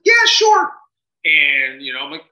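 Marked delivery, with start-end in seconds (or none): 0.0-0.7 mock eager.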